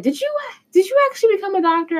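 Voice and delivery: high excited question; excited surprise. Retold or imitated. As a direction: gush it out fast and high. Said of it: "And she said".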